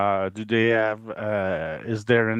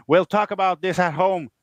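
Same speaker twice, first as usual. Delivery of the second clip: a hint of a stern mom scolding; mild mock mom warning the kid; serious mom voice; 8 semitones higher than usual. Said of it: "using my most, uh, serious mom voice".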